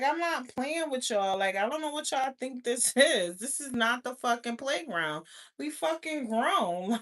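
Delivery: normal talking voice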